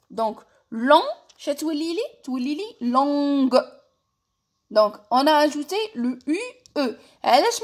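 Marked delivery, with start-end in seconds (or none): 2.9-3.6 "longue" slow, closing on a hard G; sounded final G. Shown as a demonstration. Teacher repeating the feminine form, "longue" with a hard G. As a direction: draw out "longue" and release the G. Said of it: "تاني ما رانيش ننطقه".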